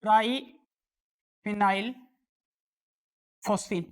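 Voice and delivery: each syllable drawn out slowly; slow, careful name dictation; slow naming. Shown as a demonstration. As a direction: spell the name out slowly for students. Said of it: "इसका नाम होता है".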